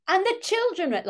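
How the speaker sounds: very high, loud mock scolding; sarcastic telling-off. Fussy listener telling off radio actors, sarcastic. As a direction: tell them off with an exaggerated swoop. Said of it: "like I'm telling them off".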